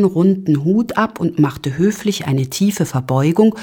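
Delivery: ordinary delivery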